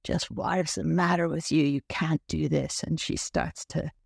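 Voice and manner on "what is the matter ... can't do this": usual speaking voice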